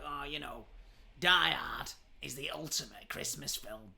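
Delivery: a hint of a smug know-it-all; lightly voiced smug bloke; mock pretentious guy. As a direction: send up the smug film bro. Mocking trilby-wearing guys.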